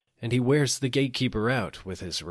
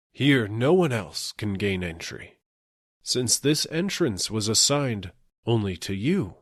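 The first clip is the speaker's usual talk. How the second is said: raised voice, not a full yell; mild shout; 3 dB louder than usual. A narrator's reading. Gatekeeper calls out to the dying man, mildly raised voice. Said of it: "he shouts at him".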